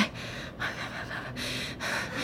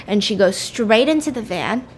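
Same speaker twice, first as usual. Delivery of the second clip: ordinary talk, nothing marked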